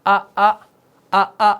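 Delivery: each hamza struck firmly and loudly as in آ، آ; teacher's loud demo of a clear glottal آ. Shown as a demonstration. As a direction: demonstrate آ with full tahqiq, loud and distinct. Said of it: "کیسے؟".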